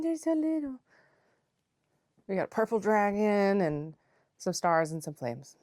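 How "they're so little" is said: cutesy coo. Real, not acted. Cooing over tiny mittens.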